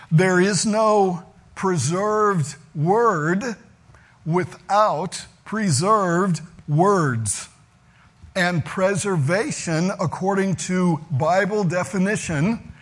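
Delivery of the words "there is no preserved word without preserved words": slow, weighty stress on "preserved word" and "preserved words". Shown as a demonstration. Preacher hammering home "preserved word" to the congregation, slow and stressed. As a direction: lean hard on "word" then "words", slowly. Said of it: "notice the emphasis in verse twelve on God's actual words".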